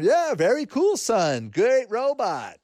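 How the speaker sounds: clueless dazed parent. Retold or imitated. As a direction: hand out empty compliments like a tranced-out parent. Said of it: "like that in-trance parent".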